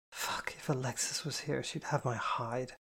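a worried mumble to herself; mumbled. Acted. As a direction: mutter it under your breath. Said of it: "she mumbled".

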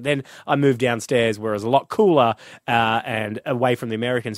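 ordinary delivery

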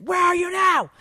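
high imitated shout down the phone; hammed-up angry holler; mock yell. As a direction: yell it like an impatient teen. Imitating daughter yelling on phone.

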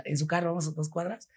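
ordinary talk

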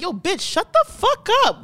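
high, drawn-out telling-off; mock-scold a friend emphatically; blunt rebuke. Telling off a friend ruining the vibe, mock exasperated.

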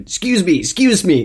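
high, loud American tourist voice; American accent. Retold or imitated. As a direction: call the waiter like a brash American. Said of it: "There were loads of Americans".

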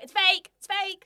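mocking shout. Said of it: "she was like".

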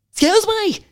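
high-pitched, hammed-up indignation; put-on affronted rant building to a challenge; mock outrage. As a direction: pick a pretend fight in defence of the town. Acted quarrel defending sea swimmers.

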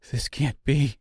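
mildly distressed disbelief; a touch of a cry in the voice; understated cry of denial. A narrator's reading. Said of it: "cried the seamstress".

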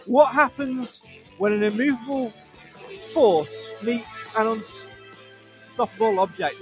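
big, high radio sign-off voice; announcer outro. Acted. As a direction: sell the sign-off like a trailer. Show outro over music.

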